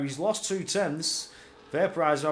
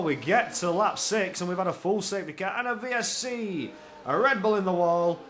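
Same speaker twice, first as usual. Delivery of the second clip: ordinary delivery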